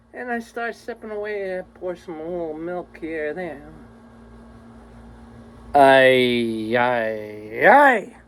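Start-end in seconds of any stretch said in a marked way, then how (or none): 5.8-8.2 exasperated groan. Real, not acted. Groaning over cold microwaved coffee.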